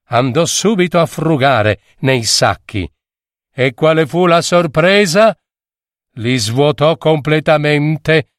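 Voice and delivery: ordinary delivery, nothing marked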